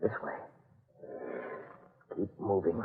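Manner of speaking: low and hushed, creeping forward. Acted. Leading the others through the dark, hushed. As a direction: keep it down so the killer can't hear.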